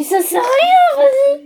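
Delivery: exaspérée. Real, not acted. Joueuse s'emporte contre l'autre.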